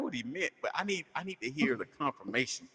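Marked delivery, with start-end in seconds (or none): none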